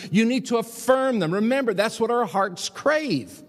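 full-force preaching. Acted. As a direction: preach it hard from the pulpit.

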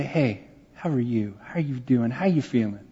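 gentle bedside greeting. Retold or imitated. Pastor planning to greet a cancer patient, gently.

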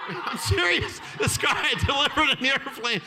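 high voice through laughter; amused, laughing protest; laughing insistence. Real, not acted. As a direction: insist it's true while laughing. Laughing while insisting it is true.

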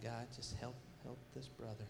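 retold feeble prayer, low and very soft; timid prayer. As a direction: pray meekly, barely audible.

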